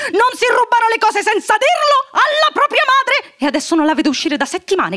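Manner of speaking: high, fussy, worried mother voice; a flustered mum venting; fretting mother. Acted. Mrs Darling frets to her husband about Wendy, high and fussy.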